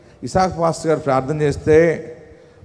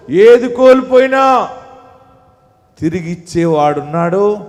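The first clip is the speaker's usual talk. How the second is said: impassioned sermon, rising again to loud; fervent preaching; 5 semitones higher, 6 dB louder than usual. Acted. Pastor assuring grieving believers, fervent preaching. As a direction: reassure them with fervour.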